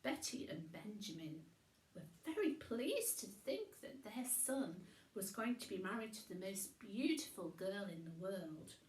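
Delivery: usual speaking voice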